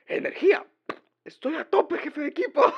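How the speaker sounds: high, full-volume Spanish meme yell; pumped-up guard shout. Retold or imitated. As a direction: bellow it like an over-caffeinated guard. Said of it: "takes another coffee and goes like".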